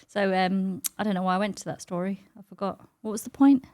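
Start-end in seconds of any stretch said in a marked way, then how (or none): none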